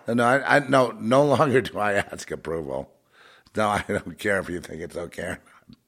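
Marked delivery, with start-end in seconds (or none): none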